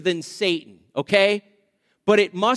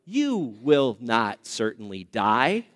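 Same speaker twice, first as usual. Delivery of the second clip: low, slow sly serpent; a slow, sly tempter's line; lightly voiced serpent, low and slow; 9 semitones lower, 3 dB quieter, slower than usual. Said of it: "He said this in Genesis three four".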